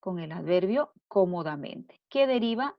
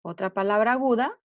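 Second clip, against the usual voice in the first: ordinary talk, nothing marked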